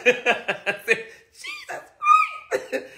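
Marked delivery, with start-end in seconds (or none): 1.3-2.8 high shocked yelp. Retold or imitated.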